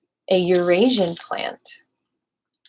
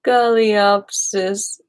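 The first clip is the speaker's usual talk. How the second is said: "Galeopsis tetrahete" spelled out slowly, syllable by syllable; slow, deliberate "Galeopsis tetrahete"; careful slow reading; slower than usual. Shown as a demonstration.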